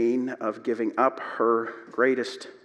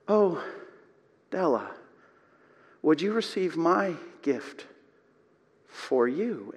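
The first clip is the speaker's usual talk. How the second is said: softer, hushed husband's tearful words; understated crying, tender and quiet; crying softly; 4 dB quieter than usual. Retold or imitated. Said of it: "Jim starts to cry".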